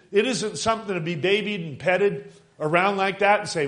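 loud, high, urgent preacher's cry; preaching at full force. Acted. Preacher urging the congregation to cry out, full force. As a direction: drive it home to the church.

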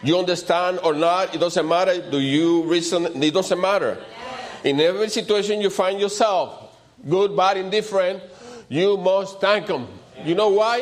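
high-pitched, insistent sermon surge; fervent preaching. Real, not acted. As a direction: drive it home to the congregation, pitch held high. Preacher building to a peak.

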